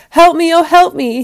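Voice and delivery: high, loud plea for help; crying out. A narrator's reading. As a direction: call out desperately as the trapped wolf. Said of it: "he cried".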